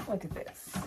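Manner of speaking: breathless hush of wonder; awed whisper. Real, not acted. Vlogger hushed in awe at a beautiful house, whispering.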